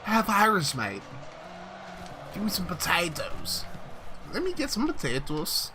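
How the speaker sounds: high, put-on Irish accent drifting Scottish; mock Irish that slips toward Scottish; bad Irish accent. Streamer retrying the accent he can't do, bad Irish accent. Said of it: "I feel like that's more Scottish".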